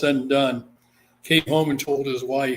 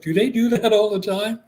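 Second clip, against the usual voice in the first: higher, a little louder, wide-eyed disbelief; surprised question; 7 semitones higher, 4 dB louder than usual. Retold or imitated. The client asks the storyteller about the priest, surprised. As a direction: retell her baffled, raised question. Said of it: "she was just like".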